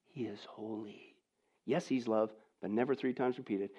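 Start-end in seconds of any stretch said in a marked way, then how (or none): 0.1-1.2 hushed awe. Acted. Preacher to his congregation, repeating God's holiness in a hushed voice. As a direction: drop to a soft, slow near-whisper. Repeating God is holy, fading.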